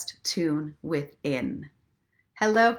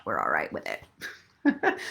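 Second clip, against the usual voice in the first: much lower, slow mock voice; mock deep voice; 4 dB quieter, slower than usual. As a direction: drop into a silly deep voice. Deep joke voice after shtick.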